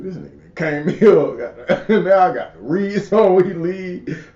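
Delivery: amused protest, laughing between words; real laughing exasperation; laughing complaint. Real, not acted. Interviewer laughs about unexpected homework.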